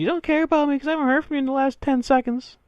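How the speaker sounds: high, whiny put-on voice of a needy girlfriend; mocking clingy girlfriend. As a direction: squeal it like a jealous partner. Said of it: "She's probably sending all sorts of text messages".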